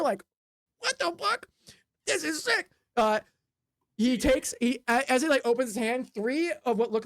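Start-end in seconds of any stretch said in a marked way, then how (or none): 0.8-2.7 high-pitched, hammed-up disbelief; mock amazement. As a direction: squeak it out as a stunned onlooker. Voicing the player amazed by trick.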